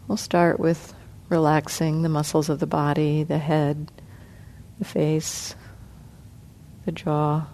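soft, unhurried meditation guidance with long pauses; guided meditation. Acted. Teacher guiding the sitting group through body relaxation, soft and calm. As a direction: ease the group gently through each part of the body.

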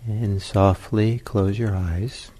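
unhurried, spacious guidance, letting each phrase settle; guided meditation. Real, not acted.

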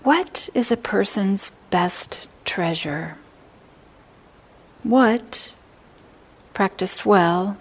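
slow, hushed reading of the sutta verses; a teacher reading scripture aloud to students, slow and hushed; verse recital. Acted.

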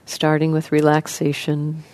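soft, even voice with long pauses; guided meditation. Acted. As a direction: gently guide listeners into relaxing, leaving space between phrases.

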